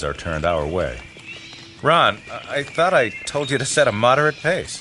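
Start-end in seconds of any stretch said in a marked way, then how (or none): 1.8-4.8 raised, higher-pitched reproach; reproachful. Acted. Leader to Ron after catching up, reproachful. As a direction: take the boy to task, voice lifted. Leader confronts boy over pace.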